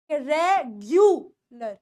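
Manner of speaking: रेगुलर drawn out very slowly as reg-yu-lar; slow model word. Shown as a demonstration. Teacher slowly spelling out रेगुलर for students. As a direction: stretch रेगुलर syllable by syllable with gyu. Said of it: "जी यू के sound में ग्यू हो जाता है".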